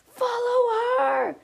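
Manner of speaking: high, drawn-out shout; loud rallying cry, stretched out. Acted. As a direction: yell it out slowly to a teammate. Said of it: "Kaya shouted while they both".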